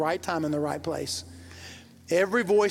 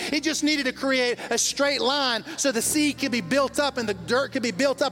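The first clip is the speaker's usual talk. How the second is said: preaching at full force; 6 semitones higher than usual. Acted. Pastor preaching to the congregation about words, full-force fervour. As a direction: lift the church with rising fervour.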